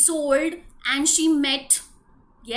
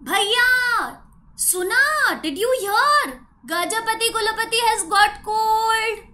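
excited gossip; 8 semitones higher, 6 dB louder than usual. Acted. Tailor spreads gossip.

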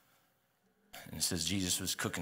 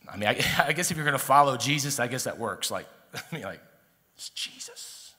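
laughing aside; 6 semitones higher, 8 dB louder than usual. Real, not acted. Laughing aside about Peter's wife.

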